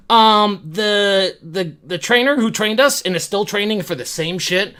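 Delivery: ordinary talk, nothing marked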